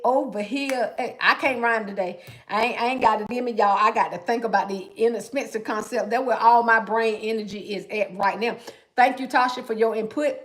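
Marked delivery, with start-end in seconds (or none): none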